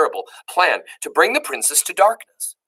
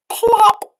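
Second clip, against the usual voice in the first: plop sound; 6 dB louder than usual. A narrator's reading. Sound word read.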